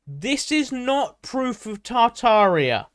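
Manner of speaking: loud, high, drawn-out declaration; emphatic loud disclaimer. Pretend. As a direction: proclaim it slowly at full volume. Emphatic mock warning to Tartaria believers.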